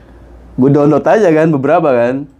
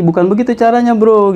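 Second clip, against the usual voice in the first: retold angry scolding; 10 semitones higher than usual. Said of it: "abang gue marah lagi".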